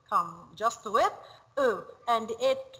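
ordinary talk

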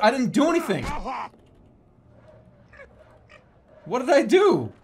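high, loud indignant yell; shouting protest. Real, not acted. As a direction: protest loudly, baffled. Protesting in game.

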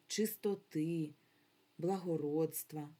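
ordinary talk

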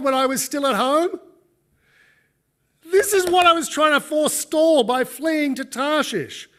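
high, loud, whining complaint to God; whiny Jonah. Acted. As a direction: wail it like a petulant prophet. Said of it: "really pathetic".

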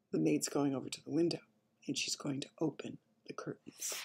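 half-whispered, very soft narration; hushed suspense. A narrator's reading. Storyteller before curtains open, hushed suspense. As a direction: draw them in with a hush.

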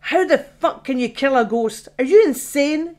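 loud disbelieving yell at Joe; angry shout. Acted. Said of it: "she shouted".

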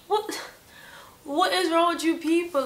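high-pitched, baffled outburst; exasperated disbelief. Retold or imitated. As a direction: demand it as if they've lost their minds. Exasperated at being called a prankster.

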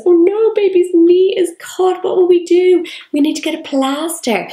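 mock alarm for a toy baby; playful pretend play. Shown as a demonstration. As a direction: act out the doll's injury with big concern. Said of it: "act it out with your baby".

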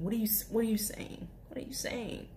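mock confused whisper. Cashier mimicking a confused customer, whispered. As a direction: whisper the question, puzzled.

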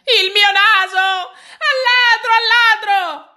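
high, loud, drawn-out wail of al ladro; frantic cry of thief. A narrator's reading. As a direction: cry out for help, stretching ladro. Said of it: "gridò il signore". (grido disperato e lento, acuto e forte)

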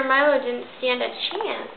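ordinary delivery

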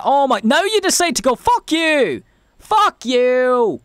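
shouting furiously at a slow truck; road rage. Real, not acted. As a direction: yell it at the driver. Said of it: "I had a bit of road rage".

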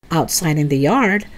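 usual speaking voice

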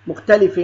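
"مختلف" pronounced mukhtalifin with a clear final n; tanween kasr. Shown as a demonstration. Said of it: "تنوين كسر".

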